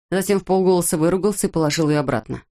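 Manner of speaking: plain reading voice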